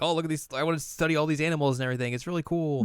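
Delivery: high, very fast fangirl gush; excited gushing. Retold or imitated. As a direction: gush eagerly as an animal-mad character. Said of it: "I just got really excited".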